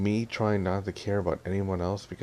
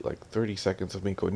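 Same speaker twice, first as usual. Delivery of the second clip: ordinary talk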